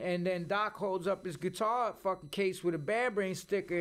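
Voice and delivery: ordinary talk, nothing marked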